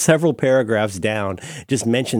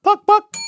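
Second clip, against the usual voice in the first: high, loud mock chicken cluck; goofy clucking noise; 8 dB louder than usual. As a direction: cluck like a hen. Clucking sound after thanks.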